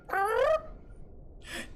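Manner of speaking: very high, squeaky birdlike trill; high bird squawk. Retold or imitated. Tiny bird wizard begging strangers, shrill chirp. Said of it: "and just going like".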